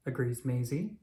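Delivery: ordinary delivery, nothing marked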